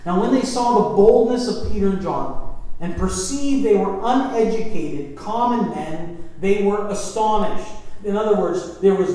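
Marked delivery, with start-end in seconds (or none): 0.0-7.7 louder, emphatic reading of the verse; scripture reading. Acted. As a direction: proclaim the passage to the congregation. Reads scripture aloud.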